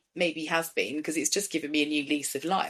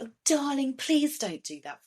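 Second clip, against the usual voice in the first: sweet parent voice; 6 semitones higher than usual. Shown as a demonstration. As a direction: coax it gently, too sweet. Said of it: "take your voice up an octave".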